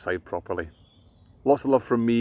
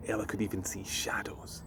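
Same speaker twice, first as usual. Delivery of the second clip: whisper; 10 dB quieter than usual. A narrator's reading. Quiet night narration.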